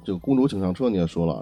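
usual speaking voice

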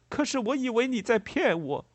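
high-pitched agitated excuse; flustered. A narrator's reading. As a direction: protest defensively in a fluster. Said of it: "农人狼狈地说道". (狼狈地高声辩解)

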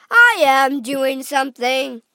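exasperated, loud protest; husband answering back to his wife; loud retort. A narrator's reading.

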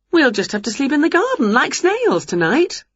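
high, put-out character voice; Winnie, put out. A narrator's reading. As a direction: pitch it up, lively and huffy.

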